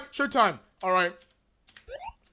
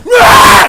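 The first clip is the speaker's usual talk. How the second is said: loud, high yell; battle yell; 5 semitones higher, 11 dB louder than usual. Acted. Gamer launching into the hardest game, battle yell. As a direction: let out a big yell to kick off.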